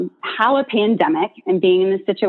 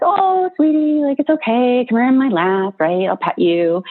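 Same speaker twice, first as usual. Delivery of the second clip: high, sweet coaxing voice that settles down; soothing a scared dog, lightly acted sweet coo; soothing puppy talk; 5 semitones higher than usual. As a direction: coo at a frightened puppy to calm it. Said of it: "tone and soothing".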